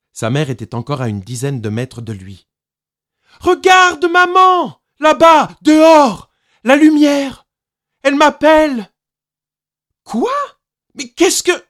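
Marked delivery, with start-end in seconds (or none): 3.4-8.9 put-on high, loud, thrilled child's shouts; child calling out in delight; excited child. A narrator's reading. Child enthralled, calling to mother. 10.0-11.6 high, stunned, breaking off; mother caught off guard; startled. A narrator's reading.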